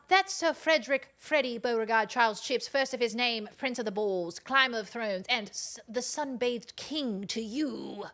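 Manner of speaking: mock-pompous herald, slowing at the end; grand proclamation. Correcting someone with the cat's full title, mock-pompous. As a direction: announce him like royalty.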